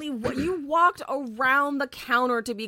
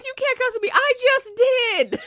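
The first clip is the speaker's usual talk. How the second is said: high, loud amused disbelief; incredulous laughing outburst; 8 semitones higher, 8 dB louder, faster than usual. Real, not acted. Mocking the customer to the cohost, loud laughing disbelief. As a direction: crack up at her hypocrisy.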